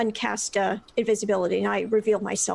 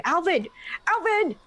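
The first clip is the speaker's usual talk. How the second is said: calling out; 7 semitones higher than usual. Acted. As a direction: call his name to catch him up. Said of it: "try to call out his name".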